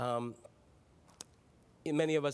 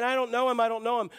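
louder, high-pitched repeated protest; insistent denial; 7 semitones higher, 6 dB louder, faster than usual. Acted. Simon Peter denying that he knows Jesus, insistent. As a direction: act out a raised-voice, emphatic denial.

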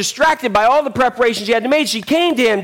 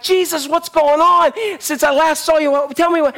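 eager chatting; 6 semitones higher than usual. Acted. Acting Mary eagerly chatting with Jesus.

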